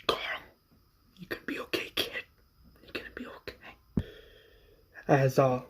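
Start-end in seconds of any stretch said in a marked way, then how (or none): 0.1-4.0 softer whispered reassurance; hushed comfort to a wounded boy; soothing whisper. Retold or imitated. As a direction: whisper it to the hurt kid. John comforting Carl.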